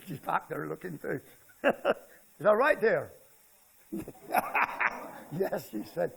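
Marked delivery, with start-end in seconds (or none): 3.9-5.3 soft unvoiced chuckle; airy laugh; breathy laugh. Real, not acted. Laughs at wife comment.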